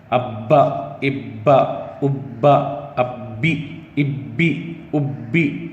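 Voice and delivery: ابا and ابی with the ب stopped hard; hard doubled consonant. Shown as a demonstration. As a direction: press the shaddah firmly. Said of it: "سختی کے ساتھ". (سختی کے ساتھ ابا)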